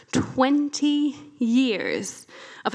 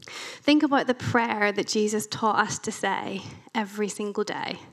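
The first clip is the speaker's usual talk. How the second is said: ordinary talk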